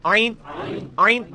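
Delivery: आइन squeezed from the middle of the throat; throat ain demo. Shown as a demonstration. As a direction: say आइन with a tight throat catch. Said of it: "लेकिन ये आइन के साथ".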